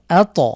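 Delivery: ত jolted loud after আ; আত with ত bounced. Shown as a demonstration. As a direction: punch the ত. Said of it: "এখানে আমরা ধাক্কা দিয়ে পড়ব".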